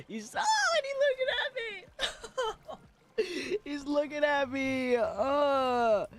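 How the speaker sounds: high, emotional near-crying squeal; tearful gushing. Real, not acted. Streamer gushing over a turtle looking back, tearful squeal. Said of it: "I'm gonna cry. I'm so emotional today".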